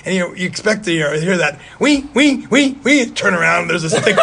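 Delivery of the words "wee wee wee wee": high, loud eerie "wee wee" screech; horror movie sting. Retold or imitated. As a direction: screech it like a slasher-film score. Mimicking a horror film sound.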